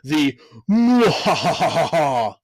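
slow evil villain cackle; mustache twirling villain laugh. Shown as a demonstration. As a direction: mwahahaha like a cartoon villain. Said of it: "the mustache twirling villain".

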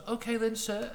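first go at a posh British croupier; put-on professional voice. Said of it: "put on the voice to sound more professional".

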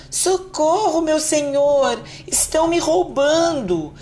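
very high, slow squealing cry; a little harp shrieking for its master; thin little voice. A narrator's reading. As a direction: harp calling to the giant for help, high and thin. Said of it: "continuava gritando com sua vozinha fina".

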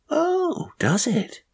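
very high, airy "oh, really"; casual answer. A narrator's reading. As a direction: toss it off lightly and high. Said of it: "so answered casually".

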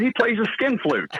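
can't keep from laughing at his own gag; telling the on-air joke while breaking into laughter; laughing through the punchline. Real, not acted.

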